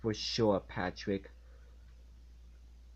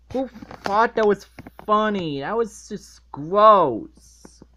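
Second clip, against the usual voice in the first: loud, higher, revolted rant; disgusted yelling; 7 semitones higher, 11 dB louder than usual. Real, not acted. Reviewer ranting at SpongeBob sleeping in litter box, loud disgust. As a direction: shout your disgust, dragging some words out.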